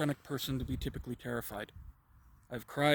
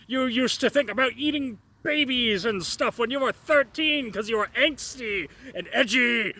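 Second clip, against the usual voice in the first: higher, slow mocking demon voice; 7 semitones higher, 4 dB louder, slower than usual. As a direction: ham up a smug demon tempting you. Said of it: "I'm mocking it right now".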